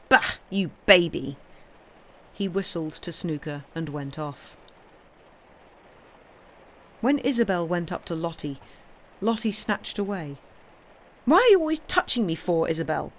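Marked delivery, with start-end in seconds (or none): 0.1-1.4 cross. A narrator's reading. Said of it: "Pip got very cross". 11.2-13.1 irritated whine. Acted. Lottie snatches away annoyed.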